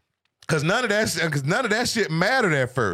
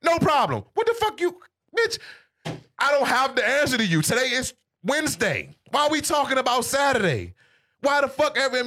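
exasperated rant; 7 semitones higher than usual. Retold or imitated. Ex texts about Saturday on a Wednesday, he rants in a high incredulous shout. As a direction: yell your disbelief at her, pitch way up.

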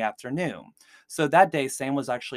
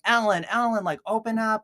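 higher, louder mock yell calling for Ellen; retold yell; 9 semitones higher, 4 dB louder than usual. Fiancé locked out, calling Ellen through door, mock yell. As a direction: call through the door like a half-acted panicked yell. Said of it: "he's, like, yelling".

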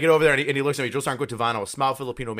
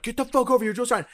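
high-pitched mock scream at underlings; retold screaming; 11 semitones higher than usual. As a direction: imitate a stressed private yelling orders, much higher. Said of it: "I was screaming at them".

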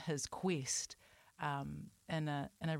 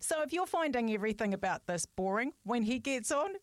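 higher, louder, bubbling with excitement; excited; 4 semitones higher, 4 dB louder than usual. Real, not acted. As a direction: hype him up with glee. Hyping up guest, laughing.

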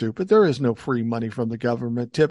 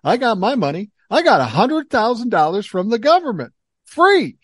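higher mocking boast, louder, voice soaring on Free; mock gloating; 7 semitones higher, 5 dB louder than usual. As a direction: taunt the scammer with a fake boast.

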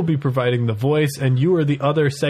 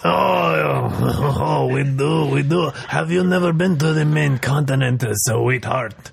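smarmy bear voice. Acted. Bear keeps flirting.

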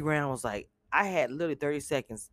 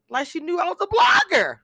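loud, high excited yell; excited shouting; 8 dB louder than usual. Real, not acted. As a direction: shout it out with glee.

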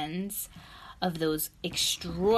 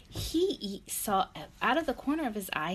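ordinary delivery

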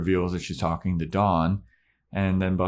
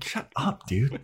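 high, laughing dismissal; mock brush-off; 8 semitones higher, slower than usual. Buffy shutting down Spike's Ramones chat, high mock annoyance. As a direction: squeak it through a laugh, playfully annoyed. Acted brush-off to a music question.

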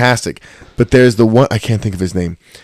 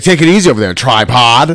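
loud, high teasing voice; hammed-up mocking taunt; mocking jab; 3 semitones higher, 7 dB louder than usual. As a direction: razz him like a smug bully. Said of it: "He's like".

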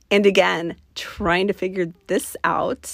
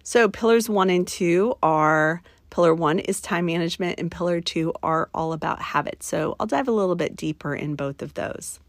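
ordinary talk

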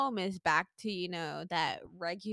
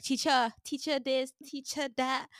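lightly voiced whiny student calling teacher; a hint of a kid telling on a classmate; tattling kid; 5 semitones higher than usual. Public school kids calling the teacher, mild whiny tattle. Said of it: "how in public school people be like".

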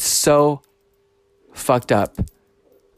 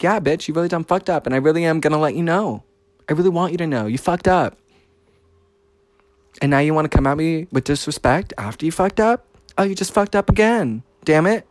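acted scolding; 5 semitones higher than usual. Retelling the row with the driver, higher-pitched scolding. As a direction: act out scolding someone who messed up. Acts out telling him off.

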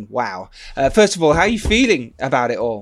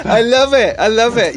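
delighted; 8 semitones higher, 6 dB louder than usual. Real, not acted. Delighted at plug.

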